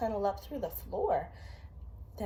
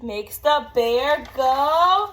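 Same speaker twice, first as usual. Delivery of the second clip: rising higher and louder into a big sneeze; building to a sneeze; 11 semitones higher, 13 dB louder, much slower than usual. A narrator's reading. Parent acting the bear's sneeze for a child, building to a loud achoo.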